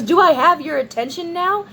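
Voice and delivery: snide imitation of the neighbor; taunting. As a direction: needle him for attention. Neighbor taunting after banging door.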